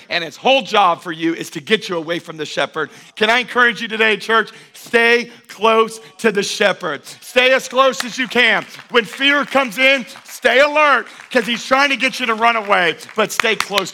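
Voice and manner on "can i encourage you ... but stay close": louder, higher rallying sermon, rising to a cry on "stay alert"; full-force preaching. Acted. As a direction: stir the church up and keep building.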